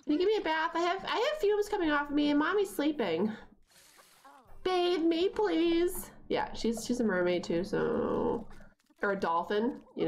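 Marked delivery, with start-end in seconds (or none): 0.0-6.1 put-on high, pleading child voice; playful voicing of a smelly child asking for a bath; whiny kid voice. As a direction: beg like a little kid for a bath. Voicing the stinky kid sim.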